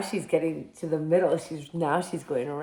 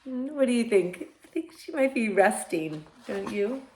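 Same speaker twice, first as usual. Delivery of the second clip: ordinary delivery, nothing marked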